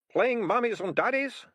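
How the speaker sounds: high, loud cheerful mockery; cheerfully. A narrator's reading. Ratcatcher teasing the kids, cheerful and high. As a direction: sing it out cheerily. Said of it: "said Ratcatcher Two cheerfully".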